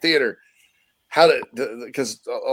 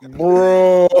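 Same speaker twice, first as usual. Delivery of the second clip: loud, higher, hammed-up Scottish burr; 5 semitones higher, 6 dB louder than usual. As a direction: roll it out as a big Scottish brogue, joking. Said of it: "they don't talk with the bro".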